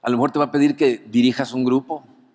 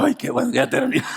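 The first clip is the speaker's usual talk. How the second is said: relieved; 10 semitones higher than usual. Retold or imitated. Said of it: "como diciendo".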